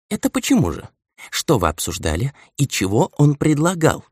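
patient questioning. A narrator's reading. Old man questions the elder about the deal, patiently. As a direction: patiently draw the details out. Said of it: "терпеливо продолжил расспросы старик".

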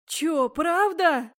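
put-on loud, drawn-out incredulous boy; amazed. A narrator's reading. Boy hears they will brew a potion, amazed. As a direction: stretch it out in wide-eyed disbelief, loudly. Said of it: "глаза вытаращились ещё больше".

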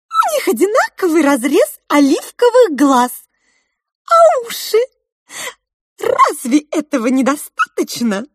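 high, loud squeaky little mouse voice; pink mouse. A narrator's reading. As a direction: pipe up like a tiny mouse. Розовая мышь говорит в сказке. (высокий голос мышки)

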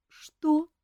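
a small sob of a question; sobbing question. Acted. Favourite answering the king, sobbing. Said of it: "всхлипнула фаворитка".

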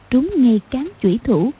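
plain reading voice

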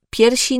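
"piersi" for "pierwsi", without the w; swallowed W. Shown as a demonstration. Diction coach showing a funny slip, w missing in "piersi". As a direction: drop the w so it becomes "piersi". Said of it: "W liczbie mnogiej powiemy więc".

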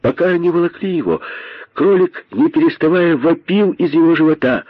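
high-pitched, excited storytelling; urgent narration. A narrator's reading. Narrator tells of the rabbit being dragged, urgent. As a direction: tell it urgently, pitch lifted.

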